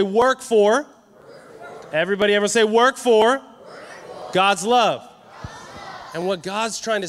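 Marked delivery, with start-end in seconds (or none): 0.0-5.1 louder, with conviction; said like you mean it. Shown as a demonstration. Congregation told to say it like they mean it, louder. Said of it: "Say it like you mean it".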